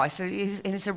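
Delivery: ordinary delivery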